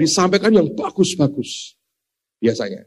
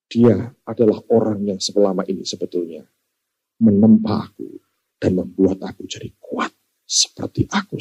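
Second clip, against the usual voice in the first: sincere tribute; 6 semitones lower than usual. Retold or imitated. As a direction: speak gently, like thanking those who carried you. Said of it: "Tapi dia berkata".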